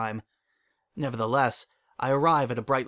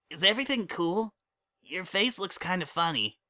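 put-on high, bouncy kid voice; young colt voice; 6 semitones higher than usual. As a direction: play an eager little colt. Child character voice.

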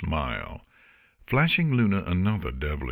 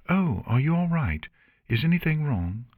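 higher-pitched, worried questions; concerned; 9 semitones higher than usual. Acted. Octavia to Artemis after her yelp, concerned. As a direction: ask with quick concern.

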